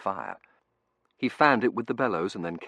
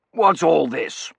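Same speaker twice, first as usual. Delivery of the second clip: loud, slow, blustering demand; raised, drawn-out bluster; demanding old gentleman; 10 semitones higher, 12 dB louder, much slower than usual. A narrator's reading. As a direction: demand it loudly as an affronted gentleman. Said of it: "he demanded".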